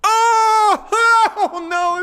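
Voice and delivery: high, loud cry of realization; laughing yelp of sudden insight; excited shout. Real, not acted. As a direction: shout out as it clicks. Realizes the answer, cries out.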